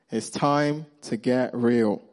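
drawn out, measured delivery; slow emphatic repeat. Real, not acted. Preacher repeating the point to the congregation, slowly and deliberately. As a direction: stretch each word to land the point.

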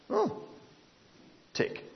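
normal talking voice

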